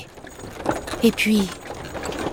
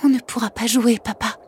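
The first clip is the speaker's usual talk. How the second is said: trembling confession; 6 semitones higher than usual. Acted.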